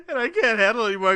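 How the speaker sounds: high, broken, laughing speech; helpless laughter. Real, not acted. Host trying to finish the sheetrock story, helpless with laughter. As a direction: squeeze the words out between laughs. Breaking up telling a funny story.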